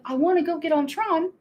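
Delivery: ordinary talk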